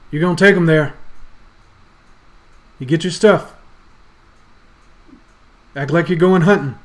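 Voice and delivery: loud, commanding father's orders; stern father. Acted. Father orders boy to lead party to woods, stern and loud. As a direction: order the boy about forcefully.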